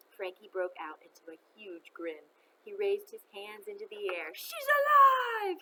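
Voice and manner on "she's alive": loud, much higher, slow stretched bellow. A narrator's reading. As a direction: throw your arms up and bellow with joy. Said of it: "he bellowed".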